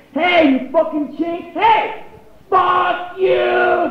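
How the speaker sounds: high, drawn-out furious shouting; road-rage shout. Retold or imitated. Dad at a driver who cut in, enraged shout. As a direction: scream it across lanes, acted full out.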